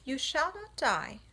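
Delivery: mild brisk reassurance; crisp and firm; understated brisk spider. Acted. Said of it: "said Charlotte briskly".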